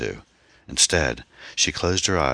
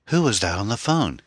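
raised-pitch, urgent demand; 8 semitones higher, 3 dB louder than usual. A narrator's reading. Jerry running over to shaken Anne, demanding. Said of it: "he demanded".

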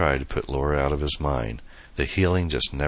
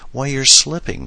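teasing; 11 semitones higher, 7 dB louder than usual. A narrator's reading. Teasing Adam.

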